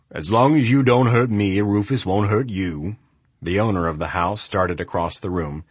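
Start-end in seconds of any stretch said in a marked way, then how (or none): 0.1-3.0 lightly voiced big man; a hint of booming warning. A narrator's reading. Adams voiced.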